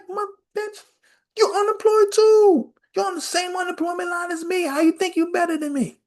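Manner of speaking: very high, loud incredulous callout; high mock outrage. Guy in line calls out the lady's hypocrisy, very high and loud. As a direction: cry out at the hypocrisy.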